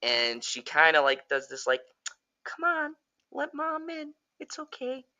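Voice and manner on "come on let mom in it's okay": much higher, soft syrupy coaxing; a creepy too-sweet lure; sweet mom voice. Retold or imitated. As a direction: demon mom switching to a gentle voice. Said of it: "she switches voices".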